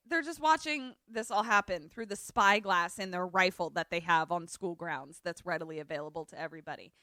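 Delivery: ordinary talk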